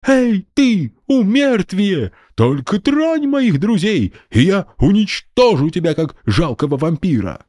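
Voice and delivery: put-on high kid's brave challenge; defiant child. A narrator's reading. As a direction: dare him like a little hero. Brave boy challenges general.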